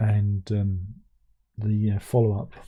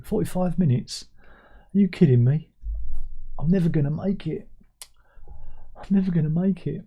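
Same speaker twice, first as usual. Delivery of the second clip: higher, hammed-up dismay; mock despair; 6 semitones higher than usual. Pretending to be a viewer who can't last the stream, hammed-up despair. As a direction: whine it like it's an ordeal.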